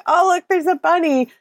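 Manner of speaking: high, delighted pointing-out; excited girlish. Retold or imitated. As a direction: squeal over a cute bunny. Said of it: "she's like".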